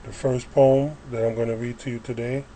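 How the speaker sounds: normal talking voice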